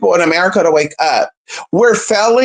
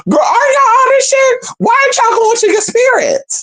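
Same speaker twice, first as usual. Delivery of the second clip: much higher, exasperated squeal; exasperated exclaim. Real, not acted. To the whining critics, high exasperated squeal. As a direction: throw it up high in disbelief.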